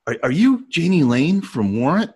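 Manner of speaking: high, slow, hesitant awe; starstruck fan. Retold or imitated. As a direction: imitate a fan timidly asking his idol. Retelling himself meeting his idol.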